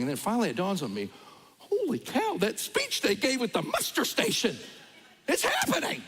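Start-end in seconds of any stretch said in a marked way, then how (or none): 1.6-6.0 alarmed realization. Retold or imitated. Sudden realization of emergency.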